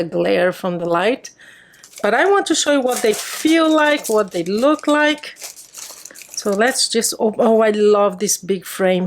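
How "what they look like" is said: ordinary delivery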